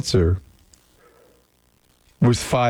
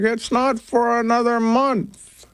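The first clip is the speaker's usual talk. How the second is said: high, drawn-out mock mom voice; mock motherly correction, slow and high; imitating mom; 10 semitones higher than usual. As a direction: mimic a puzzled mom, pitched way up. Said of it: "And she's like".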